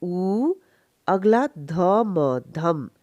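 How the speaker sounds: उ held alone, then ध, म blended into धम; careful two-part demo of उ, धम; separate syllables. Shown as a demonstration. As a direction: sound out उ and धम with pauses. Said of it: "अलग से साउंड करेगा".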